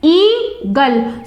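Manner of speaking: eagle broken as ea-gle; split into syllables. Shown as a demonstration. Teacher showing students two syllables, eagle split as ea-gle. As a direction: say eagle in two separate beats. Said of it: "these words have two syllables".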